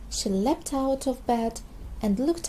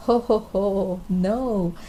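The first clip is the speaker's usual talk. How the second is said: gentle laugh; 3 semitones lower, 4 dB louder, slower than usual. A narrator's reading. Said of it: "said Mummy Pig".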